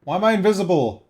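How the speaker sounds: loud hammed-up despair; mock dramatic. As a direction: play the ignored victim. Mock lament about being ignored.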